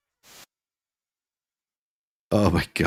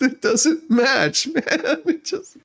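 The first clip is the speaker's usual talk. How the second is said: high giggling, slow and breaking; helpless laugh over the words; 10 semitones higher, slower than usual. Real, not acted. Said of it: "I just can't help it".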